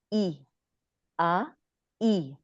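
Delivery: "ای، آ، ای" as separate ee, aa, ee; isolated vowel sounds. Shown as a demonstration. Teacher demonstrating Arabic vowels, separate pure sounds "ای، آ، ای". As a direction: sound out "ای، آ، ای" one vowel at a time, pausing between. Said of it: "double ای کی sound ہوتی ہے".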